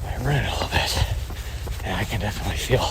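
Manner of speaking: out of breath, soft and fast; panting lightly after a run. Real, not acted. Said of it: "get myself out of breath here".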